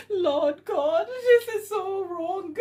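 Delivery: words squeezed out through helpless laughter; breaking up laughing at the chat; laughing to tears. Real, not acted.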